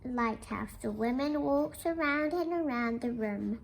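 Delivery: gentle, measured read-aloud voice; storybook reading. Acted. Teacher reading a picture book to the class, gentle storytelling. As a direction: tell it softly to the class like a bedtime book.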